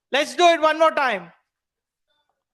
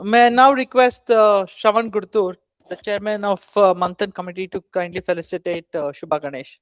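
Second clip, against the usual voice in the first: formal announcement; 7 semitones lower, 5 dB louder, slower than usual. Acted. Host announces the felicitation.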